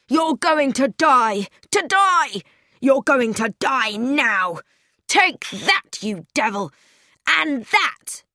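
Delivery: high-pitched, murderous acted shout; shouted threat. A narrator's reading. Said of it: "and then he shouted out".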